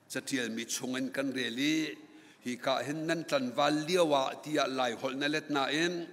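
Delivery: normal talking voice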